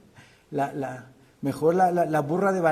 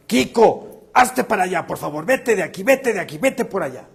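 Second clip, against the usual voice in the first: loud, raised-pitch scolding of a dog; speaking harshly; 4 semitones higher, 5 dB louder than usual. Shown as a demonstration. Preacher tests the family dog with harsh words, loud scolding. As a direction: shoo the dog away forcefully. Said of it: "Y primero le hablé fuerte".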